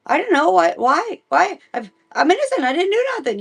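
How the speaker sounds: the king panicking that he's innocent; panicked protest. Retold or imitated. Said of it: "And he's like".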